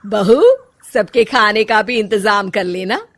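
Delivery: lightly hammed-up household matriarch; bossy mother-in-law. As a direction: order the daughter-in-law about casually. Mother-in-law orders lunch.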